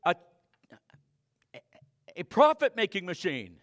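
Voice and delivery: loud, high-pitched preaching; fiery pulpit preaching; full-force preaching. Acted. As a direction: drive the sermon home to the pews. Preaching to the congregation.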